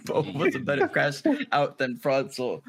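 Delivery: amused, laugh breaking into the words. Real, not acted. Teasing friends with a hot take, laughing. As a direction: say it through laughter.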